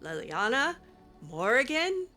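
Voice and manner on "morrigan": ordinary delivery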